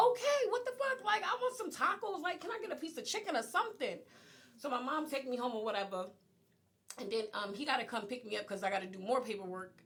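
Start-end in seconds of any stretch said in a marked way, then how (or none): 0.1-4.0 high-pitched, put-out retort; exasperated reply. Retold or imitated. Telling of her reply to being told they must talk, exasperated. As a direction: act out exasperation, voice jumping up.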